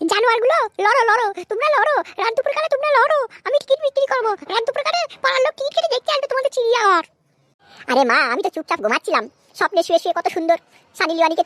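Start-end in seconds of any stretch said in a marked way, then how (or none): 0.1-7.0 high-pitched, exasperated mum; high put-on scolding voice; sarcastic mother. Skit mother sarcastically eggs on fight.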